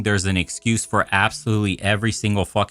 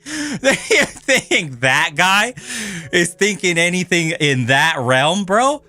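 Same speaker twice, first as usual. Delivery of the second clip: incredulous laughing rant; 8 semitones higher, slower than usual. Real, not acted. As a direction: cackle through the outrage. Mocking a commenter's naive remark.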